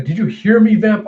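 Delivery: acted irate outburst; angry demand. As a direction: demand it sharply of someone defying you. Blood mage threatens the vampire.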